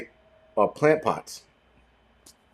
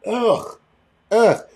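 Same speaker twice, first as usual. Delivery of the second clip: disgusted groan; 10 semitones higher, 3 dB louder than usual. Retold or imitated. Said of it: "I'm just like".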